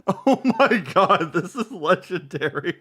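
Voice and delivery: high, loud, drawn-out laughing exclamation; cracking up in amazement; delighted awe. Real, not acted. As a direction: gasp it out through laughter. Delighted reaction to screamed rant.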